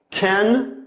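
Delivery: "ten" with open mouth, short E. Shown as a demonstration. Teacher demonstrates "ten" to the students, short E. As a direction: say "ten" with the tongue down. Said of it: "compare these sounds with words like".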